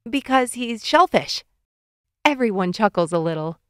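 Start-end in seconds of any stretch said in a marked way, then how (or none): 0.1-1.4 slightly hammy, higher-pitched punchline; joke punchline. A narrator's reading. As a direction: kid delivering the punchline, pitched up. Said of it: "He pauses for dramatic effect".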